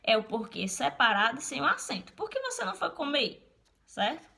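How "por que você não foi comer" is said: asked high as a question. Shown as a demonstration. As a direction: read it like a real question. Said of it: "quando eu quero fazer uma pergunta". (tom de pergunta)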